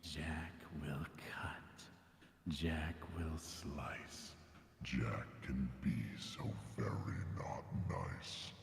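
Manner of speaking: eerie rhyming whisper through a mask; masked vocal effect. Acted. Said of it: "Jack has wires in his mask to do vocal effects".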